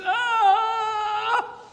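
high, loud mock scream of the damned; imitated screams. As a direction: wail like a soul in hell, hammed-up. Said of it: "They're screaming like this".

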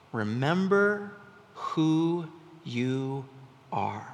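slow, hushed words falling away; a father gently slowing down each word; solemn father. Retold or imitated. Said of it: "he says to him".

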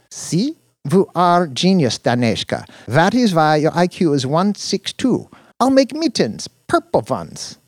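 put-on high, bright foreign girlfriend. A narrator's reading. Dina praising him.

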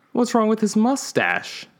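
high, slow, indignant question; offended pitch leap on wrong; defensive. Acted. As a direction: bristle in defence of the mustache. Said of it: "suddenly becoming defensive".

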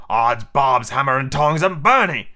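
startled captain's outburst; alarmed pirate oath. Acted. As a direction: burst out as the seat burns. Captain jumps up from hot seat.

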